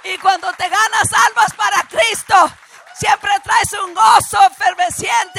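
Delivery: full-force preaching. Real, not acted. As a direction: preach it out, driving each phrase home.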